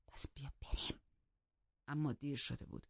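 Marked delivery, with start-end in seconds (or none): 0.1-1.0 hushed, soft urging; whispered in her ear. A narrator's reading. Hareton urges Catherine to come away, whispered in her ear. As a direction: whisper it close, barely voiced. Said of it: "هیرتون زیر گوشش گفت".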